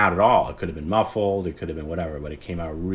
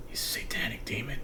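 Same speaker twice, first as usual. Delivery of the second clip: whispered sleepy insult; imitating a sleeper's whisper; sleep-talk whisper; 4 semitones higher, 11 dB quieter than usual. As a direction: breathe it out half asleep. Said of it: "it's like".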